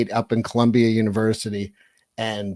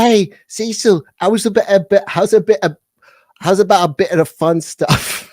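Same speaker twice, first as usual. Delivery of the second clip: a hammed-up posh English gent; posh English accent; 7 semitones higher, 5 dB louder than usual. Friend [name] teasing Cecil, posh English accent. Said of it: "much more posh English accent".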